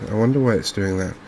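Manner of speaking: ordinary talk, nothing marked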